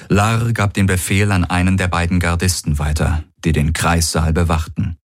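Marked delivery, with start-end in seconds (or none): none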